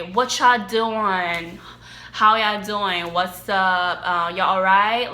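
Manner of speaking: lightly imitated Alabama drawl, slow on "y'all"; a light mock Alabama accent; Southern accent. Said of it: "you know how people talk there".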